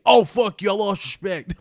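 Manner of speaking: high, loud mock outburst; imitating a furious commenter; mock angry fan. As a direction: yell it like an offended viewer.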